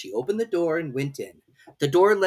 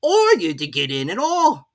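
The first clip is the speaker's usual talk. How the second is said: high, slow, haughty footman; lofty servant talking down; footman's voice; 7 semitones higher, 7 dB louder than usual. A narrator's reading. Footman figure voice.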